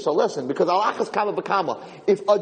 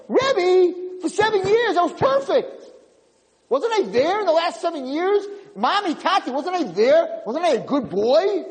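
put-on much higher, hurt child pleading; pleading child's soul; 12 semitones higher than usual. Kid's soul pleading with the rebbe and parents, much higher. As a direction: beg like a wounded little boy. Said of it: "this poor kid's neshama is saying".

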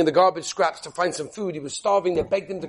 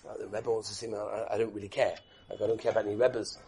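low, much softer, careless shrug of a voice; dismissive son; 10 semitones lower, 9 dB quieter than usual. Retold or imitated. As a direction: brush it off like you couldn't care less. Defiant son dismisses the Rebbe.

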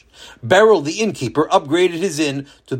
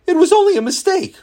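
mock excuse; 4 dB louder than usual. Mocking excuse quoted.

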